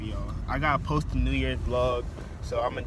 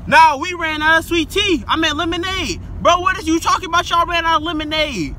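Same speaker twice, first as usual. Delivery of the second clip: very high, loud furious rant; furious yelling; 11 dB louder than usual. Real, not acted. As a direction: rant at full volume, fed up.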